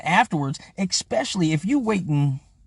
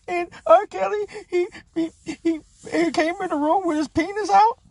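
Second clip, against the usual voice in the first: high, put-on mocking woman's voice; mocking the accuser; 12 semitones higher than usual. Mocking a woman reading her statement, high put-on voice. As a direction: mimic her reading a statement, much higher. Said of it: "she reading this shit off a piece of paper".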